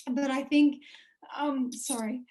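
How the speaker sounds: speaking between coughs, throat raw; coughing fit. Real, not acted. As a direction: push through a coughing fit. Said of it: "I'm having a coughing fit at the moment".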